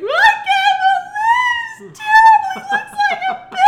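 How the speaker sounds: very high, loud mock wail; girl sobbing over her shaved dog; bawling daughter. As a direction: wail it like a heartbroken child. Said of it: "she started bawling, crying".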